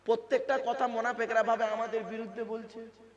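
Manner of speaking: loud, driving sermon delivery; preaching at full force. Acted. Preacher pressing the congregation, full-force preaching. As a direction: preach it hard to the crowd.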